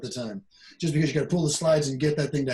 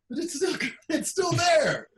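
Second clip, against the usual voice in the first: laughing; 12 semitones higher than usual. Real, not acted. Laughing at his own mix.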